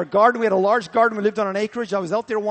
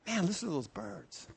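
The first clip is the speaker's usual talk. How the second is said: soft gentle voice; 13 dB quieter than usual. Acted. Holy Spirit points out birdsong.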